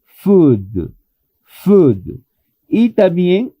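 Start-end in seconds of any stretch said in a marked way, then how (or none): 0.0-2.2 Food with a long clear oo; teacher modeling Food; model pronunciation. Shown as a demonstration. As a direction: model the word Food for repetition. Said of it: "debo de pronunciar la palabra comida".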